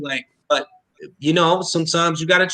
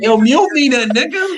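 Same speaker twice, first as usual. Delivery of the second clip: loud, high-pitched dare; defiant challenge; 10 semitones higher, 4 dB louder, slower than usual. Real, not acted. Daring the host who threatens muting, defiant challenge. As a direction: call the bluff, louder and higher.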